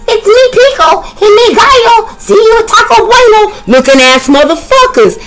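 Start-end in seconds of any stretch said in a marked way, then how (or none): 0.1-3.5 much higher, squeaky cartoon intro voice; high-pitched cartoon introduction; high character voice. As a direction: pop in as a cheeky little character. Cards introduce themselves in character.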